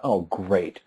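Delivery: low, soft sarcastic thought; frustrated. Retold or imitated. Dad facing a late-night store run, quietly frustrated. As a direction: drop it low and quiet, inwardly fed up. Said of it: "and I'm frustrated".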